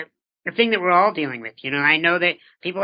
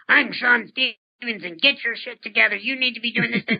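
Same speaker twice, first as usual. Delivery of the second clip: higher-pitched, lightly hammed-up indignation; mock pissy; 6 semitones higher than usual. Speaker imitating the reaction they avoid to incompetent people, mock pissy. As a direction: act out a pissy telling-off. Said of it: "I don't get all pissy and say".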